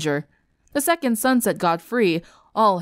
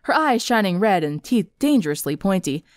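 usual speaking voice

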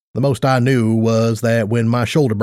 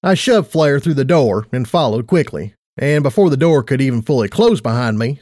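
usual speaking voice

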